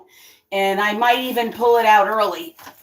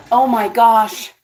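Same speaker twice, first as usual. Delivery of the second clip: normal talking voice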